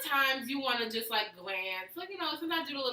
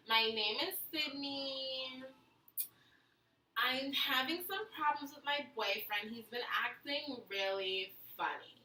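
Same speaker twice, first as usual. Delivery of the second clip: put-on Valley Girl voice; 3 semitones higher than usual. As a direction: read it out as a Valley Girl letter-writer. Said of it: "You're not gonna do your Valley Girl voice?".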